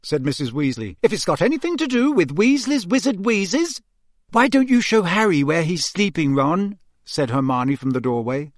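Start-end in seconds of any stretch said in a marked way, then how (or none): 1.0-3.8 high, warning maternal voice; pitched-up, threatening mum voice; exasperated mother. A narrator's reading. Mother warns about twins' mischief. 4.3-6.8 higher-pitched, leading suggestion; lifted girlish prompting; hinting girl. A narrator's reading. Hermione hints, tactfully changing subject.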